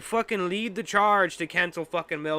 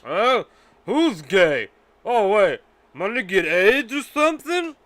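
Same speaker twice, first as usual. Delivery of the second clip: put-on drunk Mel Gibson; 3 semitones higher, 8 dB louder than usual. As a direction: ham up a drunk bigot blurting it out. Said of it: "Mel Gibson said something like this".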